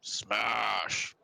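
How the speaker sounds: drawn-out ogre exclamation, falling; one long, stretched SMASH, stated as a threat. Acted. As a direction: bellow it lightly like a dim ogre. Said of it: "He looks at you and he goes".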